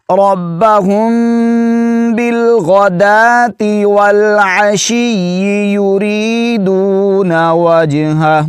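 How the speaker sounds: slow high melodic tilawat; Quran recitation. Acted. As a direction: recite slowly with long drawn vowels.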